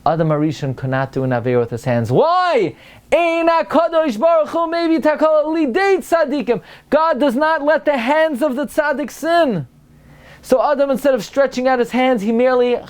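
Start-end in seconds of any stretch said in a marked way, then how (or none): 2.1-12.8 very high, exclaiming sermon climax; high-pitched, triumphant pulpit proclamation; impassioned preaching. Acted. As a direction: cry out the answer like a fervent preacher.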